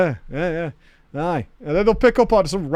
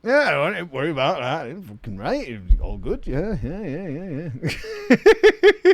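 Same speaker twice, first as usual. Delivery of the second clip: imitated Yorkshire mumble. Streamer mimicking the Yorkshire men's rambling chat, imitated Yorkshire mumble.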